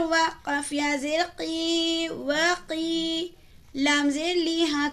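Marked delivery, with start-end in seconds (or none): none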